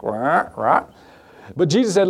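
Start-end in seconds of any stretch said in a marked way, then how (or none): 0.0-0.9 imitated harsh crow caws; crow squawk. Pastor showing kids the weird crow noises, squawking. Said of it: "they just squawk".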